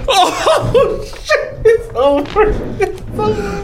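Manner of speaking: real high-pitched laughing disbelief; stunned laughter. Real, not acted. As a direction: squeal it out through helpless laughter. Stunned the voice says his name.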